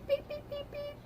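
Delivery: very high, thin whistle beeps; imitated whistle beeps. Teacher blowing the whistle at fighting girls, imitated beeps. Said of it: "she's blowing the whistle".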